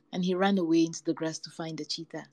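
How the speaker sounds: plain reading voice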